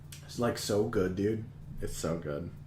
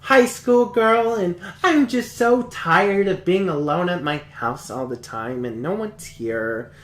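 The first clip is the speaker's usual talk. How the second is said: put-on higher, girly voice that sinks back down by the end; mock anime schoolgirl; 5 semitones higher, slower than usual. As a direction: play a lonely schoolgirl in a cartoon. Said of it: "the show starts out and it's like".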